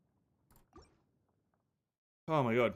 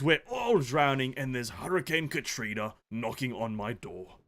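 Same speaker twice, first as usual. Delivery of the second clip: lightly voiced posh British host; a mock-posh English welcome; British gentleman. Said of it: "start being a British gentleman".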